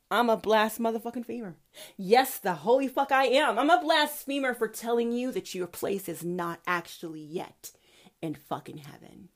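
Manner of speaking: usual speaking voice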